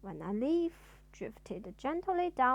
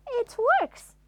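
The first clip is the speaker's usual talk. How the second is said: loud, high amazed exclaim; delighted surprise; wonderment; 11 semitones higher, 11 dB louder than usual. Acted. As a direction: cry out in delight. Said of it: "said out loud in wonderment".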